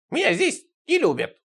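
very high, wounded squeal; offended. A narrator's reading. Сидорыч complaining he is unloved, high offended squeal. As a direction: whine it in a high, hurt character voice. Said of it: "решительно обиделся Сидорыч".

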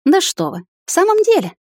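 high-pitched theatrical indignation; feigned gasp. A narrator's reading. Girl teasing the captain from a tree, feigned gasp. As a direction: gasp in mock shock, pitch soaring. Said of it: "наигранно ахнула я".

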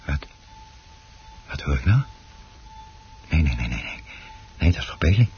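lager en luid, ontzet; geschrokken ontkenning. Acted. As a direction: weiger te geloven wat je hoort. Man hoort iets angstaanjagends. (man hoort geschrokken iets bij de plant)

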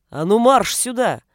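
booming fatherly order; loud angry shout. A narrator's reading. Father calling runaway son back, shouting. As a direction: shout it to haul the child back. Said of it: "выкрикнул Бруно так громко".

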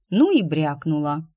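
ordinary delivery, nothing marked

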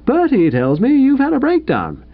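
high-pitched cheery husband; bright. A narrator's reading. Bingo to his stranded wife, brightly. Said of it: "said Bingo brightly".

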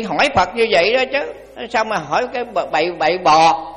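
ordinary talk

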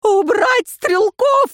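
high, loud, slow furious order; raging shout. A narrator's reading. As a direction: scream the order in a frenzy. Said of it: "бесновался Элихард". (бешеный крик)

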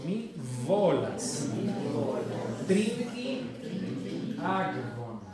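slow, word by word with long pauses; repeat-after-me model. Shown as a demonstration. Teacher says "repitan conmigo", slow exaggerated model. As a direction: draw out each word and leave room for the class. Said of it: "Repitan conmigo".

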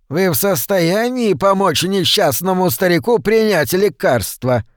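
put-on high, whining old man voice; wheedling old man. A narrator's reading. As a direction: plead like a sly frail old man. Old man character pleading.

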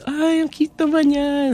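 put-on high, drawn-out coo over a child; gushing auntie. Elder lady admiring the niece in the grocery, gushing high. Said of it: "an elder lady comes up and she's".

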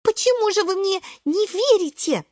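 high, wounded little protest; indignant. A narrator's reading. Шпинатик asking why nobody believes him, high and indignant. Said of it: "возмущается Шпинатик".

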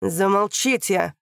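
slow loud bark; barked command. Acted. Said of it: "пролаял прокурор".

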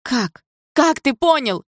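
high, loud, desperate question; crying out. A narrator's reading. Mother challenging the stepfather's conclusion, crying out. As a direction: yell it back in disbelief. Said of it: "выкрикнула мама".

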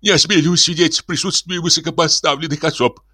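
high, servile protest; even, high-pitched gabble of deference; flustered babble. Acted. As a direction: demur humbly like an obsequious priest. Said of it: "забормотал отец Фёдор".

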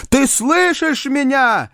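high, drawn-out cry to heaven; despairing cry. A narrator's reading. Rejected suitor calling on God at night, crying out in despair. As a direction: call up desperately to a silent God. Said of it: "кричал он".

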